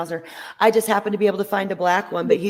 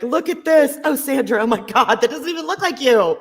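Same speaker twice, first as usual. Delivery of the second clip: higher-pitched, laughing mock amazement; excited retold reaction; 6 semitones higher than usual. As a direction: imitate a friend's tickled outburst. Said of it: "she was like".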